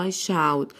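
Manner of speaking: slow 'I shout' with a clear 'ow' in 'shout'; teacher's careful reading of 'I shout'; OU sounded as 'ow'. Shown as a demonstration. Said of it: "ال O واليو بننطقها آو".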